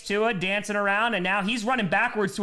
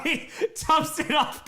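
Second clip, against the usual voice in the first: laughing through the call, higher; 12 semitones higher, slower than usual. Real, not acted. Laughing at the check-down play, high laughter. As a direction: crack up mid-call.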